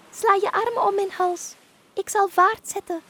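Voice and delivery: high, loud dolphin instructions; commanding dolphin. Acted. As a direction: take charge of the escape as a dolphin. Dolphin instructs witch before speeding off. (kordate, hoge dolfijnenstem)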